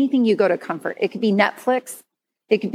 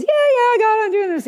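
a high, breezy brush-off, louder; brushing God off; 11 semitones higher, 3 dB louder than usual. Retold or imitated. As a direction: toss it off lightly. Mock dismissive reply to God.

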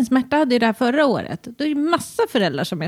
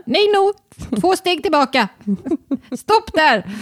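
put-on stern call to child; 6 semitones higher than usual. Calling child back, retold.

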